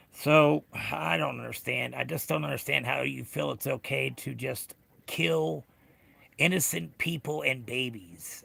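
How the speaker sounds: ordinary talk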